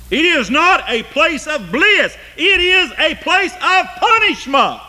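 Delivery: soaring, loud hellfire preaching; preaching at full force. Acted. Preacher warning his congregation of hell's torment, full force. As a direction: preach the torment, voice climbing high. Slow scripture build to preaching.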